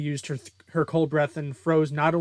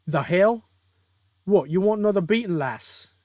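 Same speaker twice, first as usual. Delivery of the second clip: louder, higher British villain attempt; badly faked British taunt, thrown out fast; bad British accent; 6 semitones higher, 6 dB louder than usual. Villain taunting the hero, badly faked British accent. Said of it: "I can't do a British accent".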